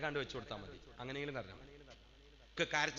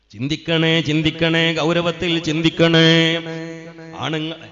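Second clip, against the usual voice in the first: loud, urgent preacher's plea; an urgent cry to ponder, at full force; preacher's loud call; 20 dB louder, slower than usual. Acted. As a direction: call out to the crowd to think, much louder. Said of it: "preacher urges the crowd to think".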